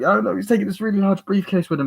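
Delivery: high, hurried mock-innocent girl; put-on high girlish pleading; imitated girl. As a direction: rush out a girl's tale in a high voice. Voicing the girl's story again.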